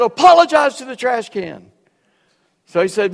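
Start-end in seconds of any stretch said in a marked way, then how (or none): 0.0-1.7 loud, raised mock command; barked order. Upperclassman ordering a cadet to apologize, barked order. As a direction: snap the order at him.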